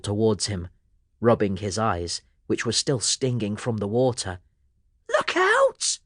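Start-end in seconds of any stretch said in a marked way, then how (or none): 5.1-6.0 very high urgent cry; shouted warning. A narrator's reading. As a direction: warn him sharply. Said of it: "Sammy shouted".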